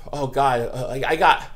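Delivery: ordinary talk, nothing marked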